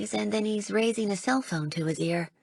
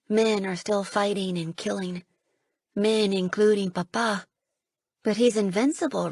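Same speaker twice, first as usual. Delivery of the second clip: ordinary delivery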